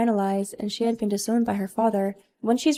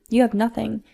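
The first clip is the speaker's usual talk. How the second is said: usual speaking voice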